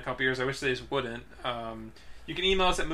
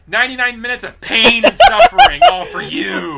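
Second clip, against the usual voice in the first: laughing shout; 6 semitones higher, 13 dB louder than usual. Real, not acted. Laughing rant about the film.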